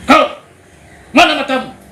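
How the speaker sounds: high, loud furious father; angry father. Retold or imitated. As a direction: scold the child who spilled milk. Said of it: "Bapaknya marah". (ayah yang membentak marah)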